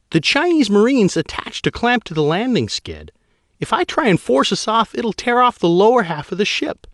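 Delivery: a pilot pushing back at her admiral; high female pilot voice, insistent. Acted. Pilot Guano's voice.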